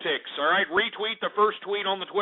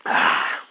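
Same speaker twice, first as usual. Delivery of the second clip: voiceless satisfied sigh after a drink; refreshed exhale. Real, not acted. Host just took a swig of beer, refreshed sigh. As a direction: breathe out after a long swallow.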